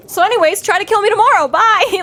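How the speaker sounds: high, airy and carefree; a high cheerful brush-off; breezy mock Harry. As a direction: toss it off like nothing matters. Mocking Harry's breezy dismissal.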